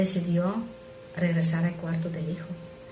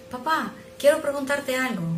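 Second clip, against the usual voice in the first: put-on child's voice; 6 semitones higher than usual.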